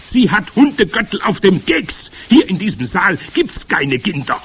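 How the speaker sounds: loud, high dismissal; scornful head witch. Acted. Head witch insists there are no children here, scornful.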